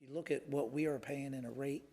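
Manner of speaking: ordinary talk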